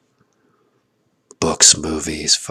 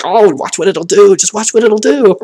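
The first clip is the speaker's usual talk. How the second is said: high, loud delighted urging; excited marvel; 13 semitones higher, 8 dB louder than usual. Retold or imitated. Watching a child's brain at work, high and thrilled. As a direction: cheer them on like a thrilled kid-watcher. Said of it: "It's like".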